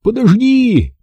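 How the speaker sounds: high-pitched pleading call; yelling. A narrator's reading. Boy begs flying dog to wait, calling out. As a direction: call out high, stretching the word. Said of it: "завопил я".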